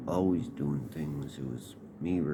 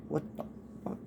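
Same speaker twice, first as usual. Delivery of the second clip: shocked disbelief; 10 semitones higher, 8 dB quieter, much faster than usual. Retold or imitated. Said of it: "I was like".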